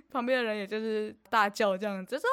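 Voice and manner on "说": ordinary talk, nothing marked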